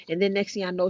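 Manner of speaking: ordinary delivery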